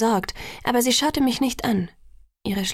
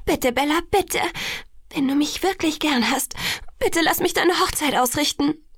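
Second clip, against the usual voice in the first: high-pitched, imploring voice; pleading; 7 semitones higher than usual. A narrator's reading. Alice begs Bella to let her plan the wedding, pleading. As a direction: beg sweetly and urgently.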